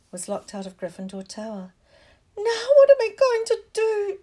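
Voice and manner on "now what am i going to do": very high, loud, shrill wail. A narrator's reading. Hermione locked out of the tower, asking shrilly. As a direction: demand it in a piercing, shrill voice. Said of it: "she asked shrilly".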